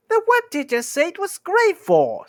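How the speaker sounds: very high, loud squawking troll; exasperated troll squeal; Bert's squeaky voice. Acted. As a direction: squeak the question at top pitch. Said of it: "the squeaky voice".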